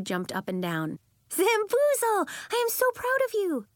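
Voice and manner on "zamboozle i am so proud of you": much higher, louder joy; excited. Acted. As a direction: squeal with pride. Jumps up and down.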